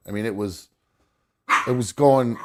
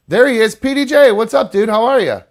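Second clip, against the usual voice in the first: much higher, louder welcome; excited greeting; 10 semitones higher, 7 dB louder than usual. Real, not acted. Greeting the caller on camera.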